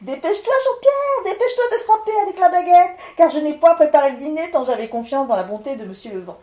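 excited wife. Acted. Claudine urging her husband to strike the tonneau, eager and hurried. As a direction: babble eagerly, urging him on.

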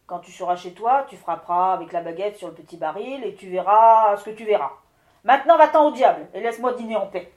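loud, ending fast and impatient; the wind lord sending the miller to the devil; commanding wind lord. Acted. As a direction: instruct him, then shoo him off. Wind lord instructs and dismisses.

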